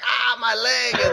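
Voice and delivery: slow, drawn-out mock moan of pain; fake injury moan. Insurance fraudster faking a leg injury, slow mock moan. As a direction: ham up a fake injury groan. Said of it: "they are like".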